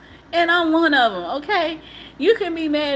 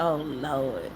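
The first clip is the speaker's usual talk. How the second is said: weary dread; 11 semitones lower, 7 dB quieter than usual. Retold or imitated. Dreading a friend's phone call.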